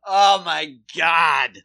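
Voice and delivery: slow, loud mock groan; exasperated dad. Soccer dad hears she teaches yoga, slow loud groan. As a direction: drag it out, fed up. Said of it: "So what do you do?".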